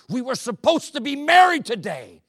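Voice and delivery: an exasperated near-shout; exasperated groom. Retold or imitated. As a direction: let the frustration break out.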